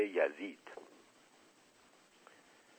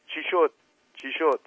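worried; 7 semitones higher than usual. Acted. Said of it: "با نگرانی پرسید".

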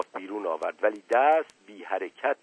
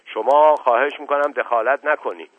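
sharp and louder; sharp reply; 3 semitones higher, 8 dB louder than usual. A narrator's reading. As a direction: cut him off sharply, louder. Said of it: "به تندی جواب داد".

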